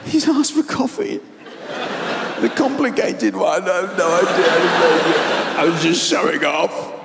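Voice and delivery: high, far-off mocking voice of the electrician; the comic squeaks out the electrician's private thoughts. Comic imitates the electrician's thoughts in a mocking high voice. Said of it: "He goes".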